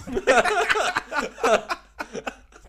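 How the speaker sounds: high loud burst of laughter; big laughing outburst. Real, not acted. Laughing at friend's question.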